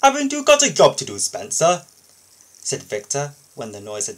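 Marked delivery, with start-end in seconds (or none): 0.0-1.8 high, loud, fast pointed question; the boss firmly putting Spencer in his place; brisk rebuke. Acted. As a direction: cut him down to size.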